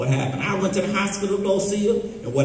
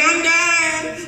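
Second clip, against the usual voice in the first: mock dying wife; 9 semitones higher than usual. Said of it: "She said".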